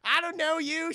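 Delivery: high, loud, slow outraged yell; meme quote yell. Retold or imitated. Quoting the purse meme, high loud yell. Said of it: "quoting a famous meme".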